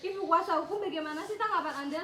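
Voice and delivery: loud call across a crowd. Acted. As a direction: raise your voice to get her attention. Reporter calling out to lawyer. (memanggil dengan keras)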